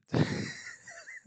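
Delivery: high, breathy burst of laughter; sudden real laugh. Real, not acted. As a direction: laugh out at the absurd knockout. Laughing at the killing blow.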